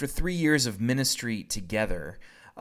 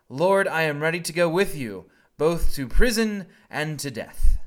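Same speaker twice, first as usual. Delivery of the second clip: bold vow; 5 semitones higher, 8 dB louder than usual. Pretend. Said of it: "What does he tell Jesus".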